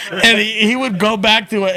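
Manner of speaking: normal talking voice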